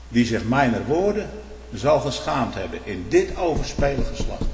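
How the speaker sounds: ordinary delivery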